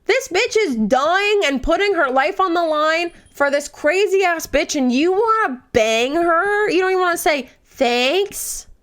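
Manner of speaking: high-pitched, swooping outrage; indignant rant. Real, not acted. Fan berating Tamlin for ingratitude, high indignant rant. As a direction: scold in disbelief, voice riding up high.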